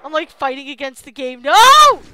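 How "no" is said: loud high panicked scream of no; full real shout of protest. Real, not acted. Gamer screaming as the game drags him down. Dragged into the floor.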